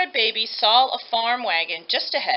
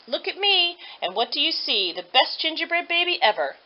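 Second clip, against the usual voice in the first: loud singsong; 6 semitones higher than usual. Acted. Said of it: "singing in a loud voice".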